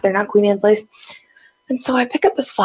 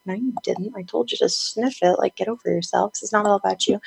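understated, much softer correction; unfazed retold rebuff; 7 dB quieter than usual. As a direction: brush off the drama quietly. God's retold calm rebuff of her.

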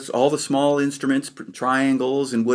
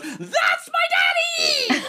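put-on high, laughing shout of a proud little boy; excited yell; much slower than usual. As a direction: belt it out like a four-year-old spotting his dad. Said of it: "I yelled out".

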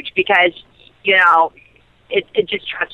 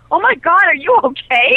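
high, mock worried gasp of concern; alarmed co-star; 9 semitones higher than usual. Co-star hearing her news, high mock alarm. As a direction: play him suddenly worried. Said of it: "And he'd be like".

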